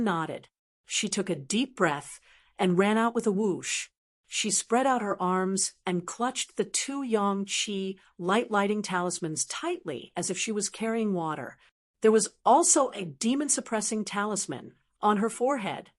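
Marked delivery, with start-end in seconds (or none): none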